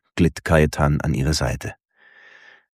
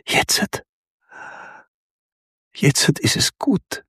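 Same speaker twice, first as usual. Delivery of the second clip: breathless, yielding whisper, pitched higher; surrendering whisper; 11 semitones higher than usual. A narrator's reading. Woman to her lover in bed, whispered surrender. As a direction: whisper it, giving in. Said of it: "flüsterte sie ergeben".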